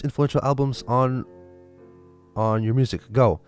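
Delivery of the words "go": ordinary delivery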